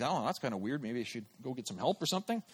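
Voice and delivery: low, soft, quickening aside; dismissive muttering. Retold or imitated. A man rationalizing away the voice he heard, muttered dismissively. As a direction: mutter it off to yourself.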